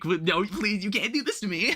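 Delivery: pleading student imitated with a laugh; TA mocks students begging for a deadline extension, laughing. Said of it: "like, beg me".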